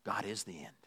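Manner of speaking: low, near-whispered reverence; hushed, yearning dog; devoted dog. Acted. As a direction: drop to a very soft, worshipful murmur. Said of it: "And a dog says".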